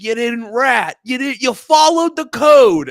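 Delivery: high, loud, proud cheering; mock cheering shout. Gangsters cheering the kid who didn't rat, loud. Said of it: "They're like".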